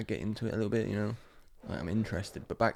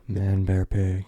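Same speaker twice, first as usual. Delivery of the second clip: loud mock announcement; 8 dB louder, much slower than usual. Quoting a South Park bit.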